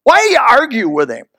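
ordinary delivery

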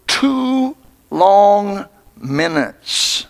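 two long minutes, dragged out word by word; slow, held vowels to make time crawl; drawn-out emphasis. A narrator's reading. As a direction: stretch each word very slowly. Stretching out the time of suffering.

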